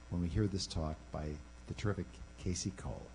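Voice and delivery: ordinary delivery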